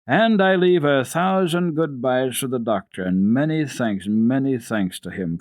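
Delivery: put-on slow, careful child's farewell; earnest thanks. A narrator's reading. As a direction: say it slowly and sincerely. Heidi's earnest farewell message.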